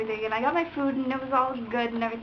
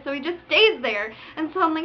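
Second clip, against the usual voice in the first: ordinary talk, nothing marked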